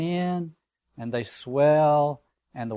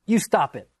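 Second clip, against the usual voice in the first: high, loud and sharp protest; shrill; 6 semitones higher, 5 dB louder than usual. Acted. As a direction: snap it out shrilly like a frightened boy. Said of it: "said Piggy shrilly".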